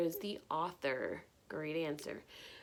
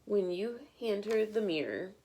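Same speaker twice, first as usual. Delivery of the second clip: lively picture-book read-aloud; bright, expressive storytime reading; reading to children; 3 dB louder than usual. Acted. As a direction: read it like storytime for little ones.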